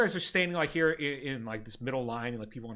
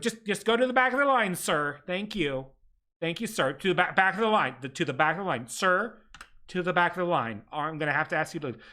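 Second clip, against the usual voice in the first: stern clerk. Acted. Clerk orders the applicant to the back of the line. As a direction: send him off firmly.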